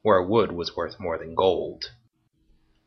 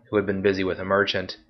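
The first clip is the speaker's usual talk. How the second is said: ordinary delivery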